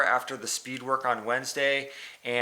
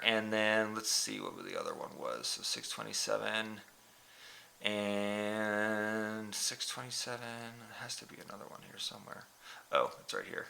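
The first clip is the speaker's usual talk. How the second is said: muttering to himself; 10 dB quieter than usual. Real, not acted. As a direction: mumble it to yourself while searching. Muttering to himself over data.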